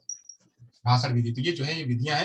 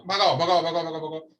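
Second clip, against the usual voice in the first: hurried skipping. Acted. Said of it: "भगाते इसको forward करते".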